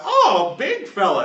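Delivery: high, loud granny exclamation; grizzled old lady. Acted. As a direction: fuss over them like an old woman. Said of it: "your buddy and she's like".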